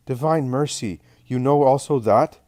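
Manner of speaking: high, astonished exclamation; utterly amazed. A narrator's reading. Man learning that Jesus knows of Judas, utterly amazed. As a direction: rise to a loud, incredulous question. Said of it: "He is utterly amazed".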